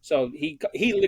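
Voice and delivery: ordinary talk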